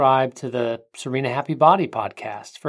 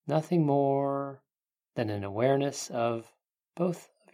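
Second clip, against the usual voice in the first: guided meditation; 3 dB quieter than usual. Acted. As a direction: ease them into calm, unhurried.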